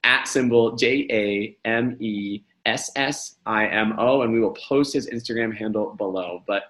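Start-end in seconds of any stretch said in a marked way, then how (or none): 0.0-4.2 slow spelling. Shown as a demonstration. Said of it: "One more time".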